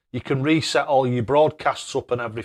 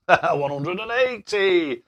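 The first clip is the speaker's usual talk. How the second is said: slow, drawn-out mock darts caller; 5 semitones higher, much slower than usual. Streamer thanking a viewer with a mock darts-caller call. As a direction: stretch it out like a tournament caller announcing a maximum.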